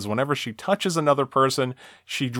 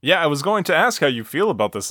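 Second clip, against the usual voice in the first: normal talking voice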